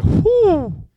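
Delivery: high, loud exhaled "whew"; high whoop of a sigh, acted out; re-enacted whew. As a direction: let out a big high-pitched whew. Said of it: "I was like".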